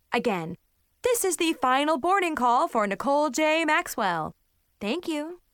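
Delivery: insistent final boarding call over the PA; airport announcement. Acted. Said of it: "another disturbing announcement".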